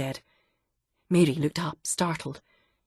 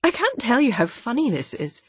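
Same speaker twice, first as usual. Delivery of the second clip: laughing; 7 semitones higher than usual. Acted. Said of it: "Mary was laughing".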